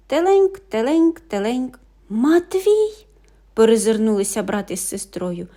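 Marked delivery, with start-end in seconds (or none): 0.1-1.8 high tinkling ding-a-ling fading; bell ring. A narrator's reading. Little bells ringing on the sleeping brother. 2.1-3.1 high loud surprised; realisation. A narrator's reading. Siblings realising the little brother is tied, loud.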